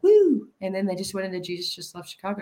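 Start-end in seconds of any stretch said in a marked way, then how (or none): 0.0-0.5 high loud whoop; excited cheer. Retold or imitated. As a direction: cheer like the band just nailed it. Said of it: "I was like".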